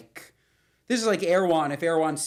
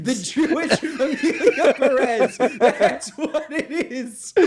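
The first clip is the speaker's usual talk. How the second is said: high, slow words through laughter; laughing while talking; 7 semitones higher, 5 dB louder, slower than usual. Real, not acted. Laughing with her husband over a joke, slow high laugh.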